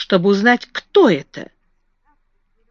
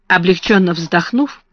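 usual speaking voice

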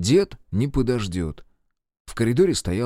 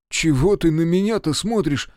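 raised, startled question; surprised; 6 semitones higher, 4 dB louder than usual. A narrator's reading. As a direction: ask it taken aback, pitch up. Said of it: "удивился тот". (удивлённо переспросить)

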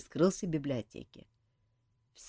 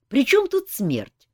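a mildly angry, louder retort; 10 semitones higher, 8 dB louder than usual. A narrator's reading. Jak snapping at the nymph about death and love, mildly angry. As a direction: brush off her nonsense with irritation. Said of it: "рассердился Жак".

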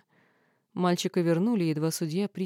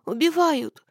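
high, loud cry; strangled scream; 9 semitones higher, 6 dB louder, slower than usual. Acted. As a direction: shriek it while being choked. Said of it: "попыталась завопить я".

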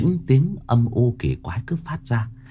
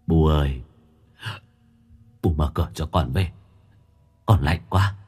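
mournful ghost; 6 semitones lower than usual.

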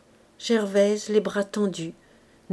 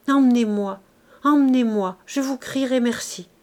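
louder, higher, repeated begging; passionate pleading; 4 semitones higher, 5 dB louder than usual. Acted. Gervaise on her knees before Bazouge, desperate pleading. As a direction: cry out to be taken away. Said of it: "se mit à le supplier avec passion".

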